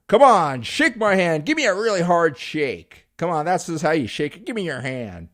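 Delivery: loud, high, pushy "come on, shake my hand"; mock overbearing handshake guy; pushy handshaker. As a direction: badger them to grip harder. Acted pushy hard-handshake guy.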